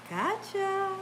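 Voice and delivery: high, drawn-out sly taunt; teasing gotcha. Retold or imitated. Lady turning round before take-off, high mock teasing. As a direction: imitate a sneaky tease. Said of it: "she went like this".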